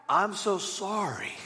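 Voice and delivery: soft, slow, sheepish apology; hammed-up contrite pastor caught keying the car; mock apology. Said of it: "and I'm like".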